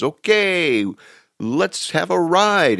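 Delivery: high, slow, playful taxi driver voice; gleeful drunk driver. Retold or imitated. Drunk cabbie to his passengers, high and gleeful. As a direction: drawl it like a tipsy cabbie. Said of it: "He says".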